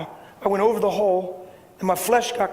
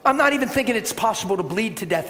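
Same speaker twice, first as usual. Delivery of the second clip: ordinary talk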